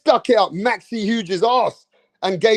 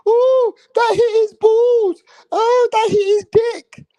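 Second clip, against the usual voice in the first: high, slow mocking whine of a fan; mock the fans crying foul, high and drawn out; mocking whiny fan; slower than usual. Ranter mocks whiny boxing fans in a high slow voice. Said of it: "Acting like some blind bitches".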